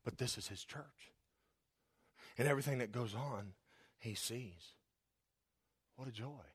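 low, soft, reverent pastoral appeal; hushed earnest preaching. Real, not acted. Preacher urging church musicians to play for God, hushed and earnest. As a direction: drop right down and let it sink in, almost to yourself.

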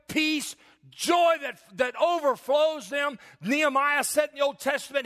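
high, slow, ringing proclamation; full-force preaching. Real, not acted.

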